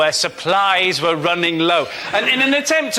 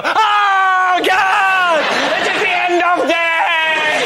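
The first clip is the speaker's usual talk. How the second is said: high, loud hammed-up panic; hammed-up English panic at snow; mock panic; 11 semitones higher, 3 dB louder, much slower than usual. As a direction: wail like the world is ending. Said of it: "English people go".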